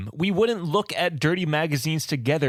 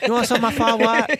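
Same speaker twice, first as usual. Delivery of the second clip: mock Chinese accent; 5 semitones higher, 4 dB louder than usual. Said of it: "making fun of South Park".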